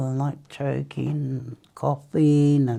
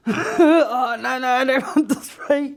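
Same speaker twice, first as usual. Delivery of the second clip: laughing refusal; 8 semitones higher than usual. Retold or imitated. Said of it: "I went".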